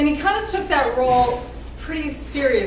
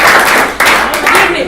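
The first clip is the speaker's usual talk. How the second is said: calling out; 3 semitones higher, 11 dB louder, much slower than usual. Real, not acted. Toast speaker calls for attention.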